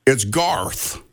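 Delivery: a lightly voiced older man calling, different timbre; Garth on the phone. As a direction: gently imitate the caller asking for Bill. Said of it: "That's what he says".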